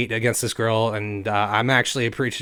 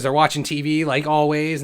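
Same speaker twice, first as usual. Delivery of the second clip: ordinary delivery, nothing marked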